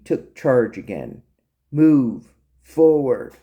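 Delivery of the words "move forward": loud, separated commands; firm military command; marching orders. Acted. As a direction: order the prisoner onward, word by word. Major ordering prisoner along.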